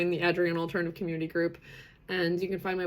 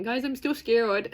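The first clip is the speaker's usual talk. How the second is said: mock scared admission; 4 semitones higher, 5 dB louder, slower than usual. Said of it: "here I am a week later saying".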